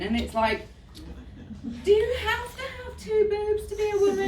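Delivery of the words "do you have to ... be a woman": incredulous retort. Retold or imitated. Mocking the question, incredulous.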